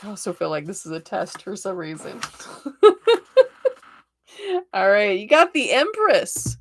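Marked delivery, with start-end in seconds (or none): 2.2-3.7 high, loud peal of giggles; real burst of laughter. Real, not acted. Reader amused at feeling tested, laughing.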